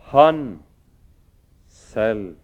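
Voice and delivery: «han» trukket høyt og hardt fram; slå an «han selv» med tyngde; stress on han. Real, not acted. Preacher stresses Samuel himself. (sterk trykk på «han»)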